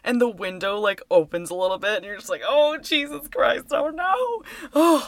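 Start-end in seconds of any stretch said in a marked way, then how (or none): 2.5-5.0 high, drawn-out cry of dismay; dragged-out horror-viewer "oh no"; mock dread. Horror fan acts out watching the window creep open, high mock dread.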